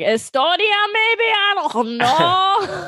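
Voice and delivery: high, slow mock European accent; vague foreign accent. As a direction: drag it out with a shrug. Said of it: "He's like".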